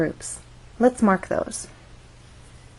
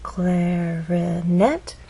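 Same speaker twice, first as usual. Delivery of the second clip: "clarinet" drawn out slowly, rising sharply on "net"; stressed final syllable; much slower than usual. Shown as a demonstration. Teacher showing three syllables, final one stressed and rising. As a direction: stretch "clarinet" syllable by syllable, last one glides up. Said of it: "it's the final syllable that is stressed".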